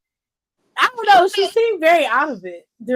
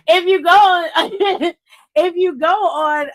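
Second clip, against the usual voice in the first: laughing; 6 semitones higher, 6 dB louder than usual. Real, not acted. Laughing restart.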